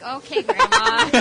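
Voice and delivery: high, loud laughing "okay"; retold taken-aback reply. Granddaughter answering grandma's confession, amused disbelief.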